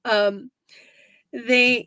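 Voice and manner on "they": normal talking voice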